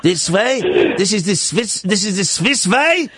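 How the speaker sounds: hammed-up plotting in Swiss accent; mock-solemn Swiss villager's accent. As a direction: conspire in a silly Swiss accent. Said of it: "It's a Swiss accent".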